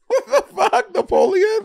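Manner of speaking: very high, baffled outburst; incredulous squeal. Real, not acted. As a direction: squeak it in total disbelief. Baffled by the Napoleon question.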